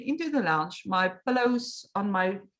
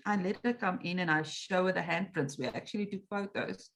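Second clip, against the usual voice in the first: ordinary delivery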